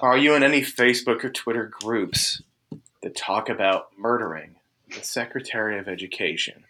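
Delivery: low, soft, weary recitation; official questioning. Retold or imitated. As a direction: read out the form question, voice sinking. Marshal reciting form questions, acted.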